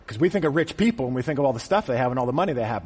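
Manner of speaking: normal talking voice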